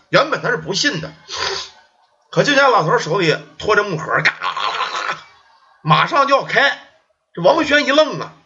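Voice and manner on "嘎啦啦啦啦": low rumbling rattle of a wooden box about to burst open; rattling box noise. Acted. The ghost box rattling as it starts to open, low rumbling clatter.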